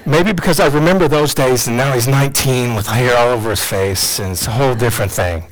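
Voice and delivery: high, choked-up emotion; real tearful crying. Real, not acted. Father remembering his son's birth, tearful and overcome. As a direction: talk through real tears, pitch climbing. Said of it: "I don't know why I'm crying right now".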